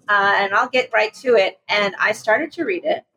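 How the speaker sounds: ordinary delivery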